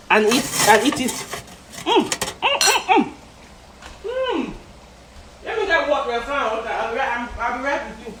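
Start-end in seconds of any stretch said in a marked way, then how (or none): 1.8-4.5 savoring hum. Real, not acted. Tasting chicken, savoring. 5.4-8.1 talking with mouth full; words round a bite of chicken. Real, not acted. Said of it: "I'm tasting the chicken again".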